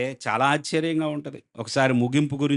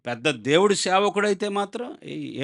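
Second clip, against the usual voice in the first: ordinary talk, nothing marked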